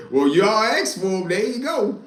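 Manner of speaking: amused, rising mock; laughing taunt. Taunting the police about his friend, laughing. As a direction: laugh it at the cops.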